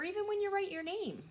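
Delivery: put-on high, fast, strained little-kid voice; child frustration. Teacher mimicking a frustrated child writing their name, high and fast. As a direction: squeak it out like a fed-up child.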